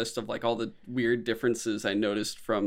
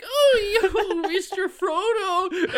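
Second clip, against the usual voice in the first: very high, whining hobbit impression; drawn-out mock wail, hammed-up and squeaky high; cartoon Sam; much slower than usual. Podcaster mocking the cartoon hobbit's voice, high drawn-out whine. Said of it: "and then in this one".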